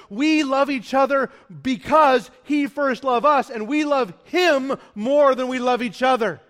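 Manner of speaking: loud, fervent preaching; preaching at full force. Real, not acted. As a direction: preach it hard to the crowd.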